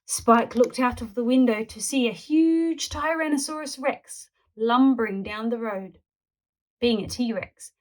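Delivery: usual speaking voice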